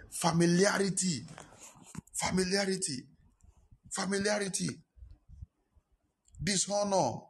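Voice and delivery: high, drawn single words called out; preaching proclamation. Real, not acted. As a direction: proclaim each word like a verdict. Preaching cry of key words.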